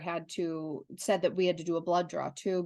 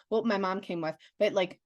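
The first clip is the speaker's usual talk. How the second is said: ordinary talk, nothing marked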